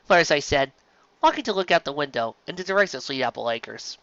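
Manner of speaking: ordinary delivery, nothing marked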